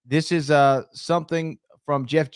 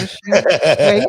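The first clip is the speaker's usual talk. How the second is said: laughing plea; 7 semitones higher, 8 dB louder than usual. Real, not acted.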